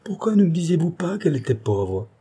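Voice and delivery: high-pitched reproach; pained, indignant question; accusing. A narrator's reading. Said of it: "accusant ceux qui se trouvaient là".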